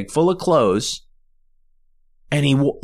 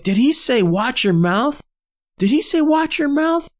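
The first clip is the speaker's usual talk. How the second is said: high-pitched mock outrage; incredulous, riled-up drunk fan; kinda mad. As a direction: imitate an offended drunk, stretching the last words. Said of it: "Like he's kinda mad".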